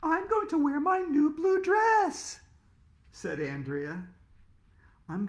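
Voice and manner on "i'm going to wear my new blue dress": put-on high, slow sing-song girl; prim little girl showing off; prissy girl voice. Girl character voiced in story.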